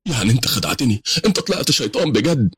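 high, betrayed questioning; shocked disbelief. Acted. Adam realises his friend tricked him, high and incredulous. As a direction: let the voice climb in dismay.

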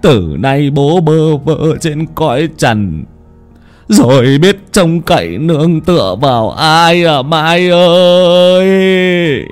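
grieving reproach. Acted. Old father wailing reproachfully over his dead daughter. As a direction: mourn and blame the dead daughter. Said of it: "thẫn thờ mà nói như là oán, là trách".

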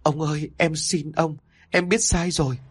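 a wife pleading with her cruel husband, close to tears; tearful begging. Acted. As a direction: beg him through tears, voice lifting. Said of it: "Cô khóc lóc van xin".

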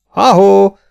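loud, high hailing cry; wheezy hoarse shout. A narrator's reading. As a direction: holler out to the rider, high and loud. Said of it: "kiáltotta Bart is sipoló, köhögő, reket hangon".

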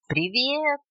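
a hint of a singing little bird; singsong bird greeting. A narrator's reading. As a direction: sing out the greeting. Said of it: "пропела неизвестно откуда появившаяся птичка".

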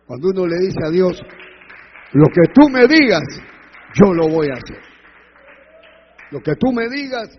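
booming vow, easing toward the end; loud preaching. Acted. Preacher models a believer's promise to God, loudly proclaimed. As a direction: proclaim it at full force, then settle.

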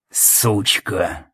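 low, quiet, venomous mutter; angry hiss. A narrator's reading. Jackson cursing the woman as she leaves, angry hiss. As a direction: spit it under your breath. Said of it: "зло прошипел Джексон".

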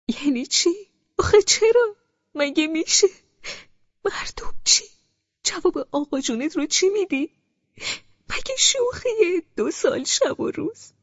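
high, wailing, disbelieving questions; tearful mother. A narrator's reading. Mother in tears questioning her daughter, high wail. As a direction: cry out the questions through tears. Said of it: "اشک ریزان پرسید".

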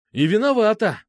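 high, emphatic outburst; accusing exclamation. A narrator's reading. Petrushka blames Alfa outright, accusing exclamation. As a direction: jump in with a heated accusation. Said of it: "воскликнул Петрушка".

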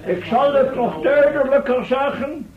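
calm the crowd from the podium; formal speech. Acted. Professor continues his address. (plechtige toespraak, soms vertraagd)

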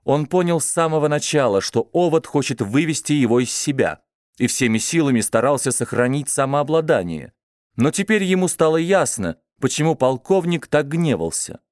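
plain reading voice

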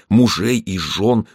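ordinary delivery

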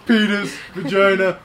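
loud excited guessing-game blurts; mock blurted guesses. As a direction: blurt them out like a quiz answer. Acting their guesses at drawing.

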